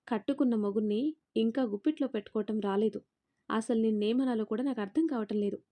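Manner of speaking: plain reading voice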